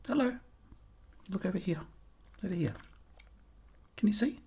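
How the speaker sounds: soft high coo to a puppy; puppy talk. Real, not acted. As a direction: coo at the puppies like babies. Baby talk to puppies.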